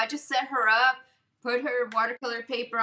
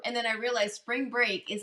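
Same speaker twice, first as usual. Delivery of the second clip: ordinary delivery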